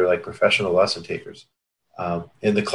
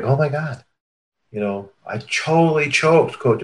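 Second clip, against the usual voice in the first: higher, half-mocking panicked kid voice; imitating upset junior; 6 semitones higher than usual. Junior telling coach about the failed tryout, mock upset. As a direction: play the teen who just choked, a bit hammed-up. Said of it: "they're crying and they're freaking out".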